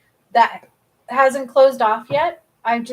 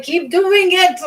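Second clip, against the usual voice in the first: emphatic advice; 8 semitones higher, 8 dB louder, slower than usual. Retold or imitated. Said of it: "And he's like".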